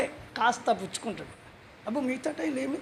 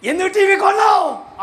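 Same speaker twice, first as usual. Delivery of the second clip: loud high demanding question; mock accuser shouting at a believer over the TV; accusing shout; 8 semitones higher, 8 dB louder than usual. Preacher mimics accusing question.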